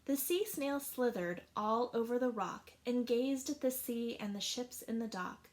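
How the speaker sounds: plain reading voice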